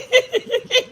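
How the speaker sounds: short shrill burst of laughter; high squealing laugh. Real, not acted. Laughing fit.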